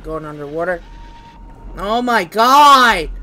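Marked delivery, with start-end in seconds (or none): 1.8-3.1 loud, drawn-out cry of dismay; dismayed yell. Real, not acted. Player who hates going underwater, dismayed yell. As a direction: stretch the words into a loud wail.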